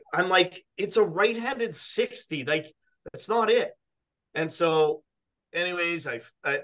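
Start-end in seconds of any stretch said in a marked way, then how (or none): 0.8-3.7 higher, deflated disbelief; mock dismay at the wrong club; retold exasperation. Seeing the official's left-handed wedge: higher exasperated correction.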